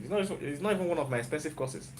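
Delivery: normal talking voice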